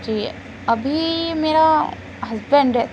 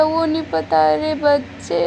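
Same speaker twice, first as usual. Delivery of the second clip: ordinary delivery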